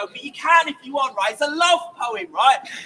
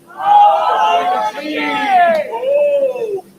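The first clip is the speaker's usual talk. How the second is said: big loud shout, higher; crowd yell; 6 semitones higher, 5 dB louder, much slower than usual. Real, not acted. Street crowd answering the poet, loud shout. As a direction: belt it out for volume. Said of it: "nine out of ten for volume".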